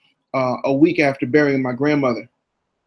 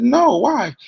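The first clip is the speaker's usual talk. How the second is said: put-on little boy asking; 9 semitones higher than usual. Child self voiced in story.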